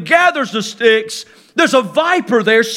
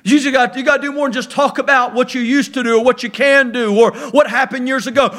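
ordinary talk

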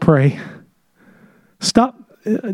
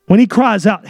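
ordinary talk, nothing marked

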